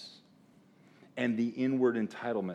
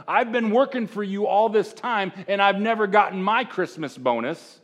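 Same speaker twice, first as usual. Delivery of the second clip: mock complaint, a bit higher and louder; a hint of a bitter worker; resentful older son; 9 semitones higher, 6 dB louder than usual. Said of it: "That's what he's saying".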